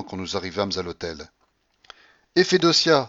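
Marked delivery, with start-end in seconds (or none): 2.3-3.1 high, loud cry; exclaiming. A narrator's reading. Grandmother suddenly remembers the old maid, exclaiming. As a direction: cry out the name. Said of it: "s'écria la babouchka".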